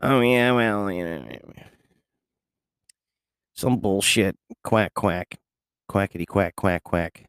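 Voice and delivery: mock the know-it-alls' empty chatter; mocking know-it-alls. Mocking know-it-alls repeat nonsense, dismissive quacking parody. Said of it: "they tell me all the time, they're like".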